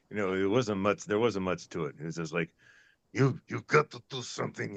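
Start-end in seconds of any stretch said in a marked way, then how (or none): 3.1-4.8 put-on quoting his film role in a light Russian accent; a hint of a Russian warning, higher; lightly voiced Russian accent, urgent warning. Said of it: "You should be able to do the Russian accent".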